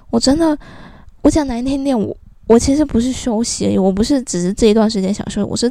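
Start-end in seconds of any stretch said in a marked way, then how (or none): none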